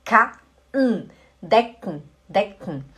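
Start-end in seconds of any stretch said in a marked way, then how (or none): 1.4-2.9 decken with a sharp, catching k like a hiccup. Shown as a demonstration. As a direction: snap the k in decken short, like a hiccup. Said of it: "Parece que você tá assim com soluço".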